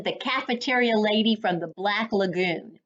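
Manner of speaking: plain reading voice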